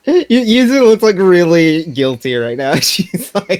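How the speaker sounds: high, loud burst through giggles; high-pitched, loud, giggly delivery; laughing. Real, not acted. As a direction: crack up while describing it. Giggling at her character's guilty look.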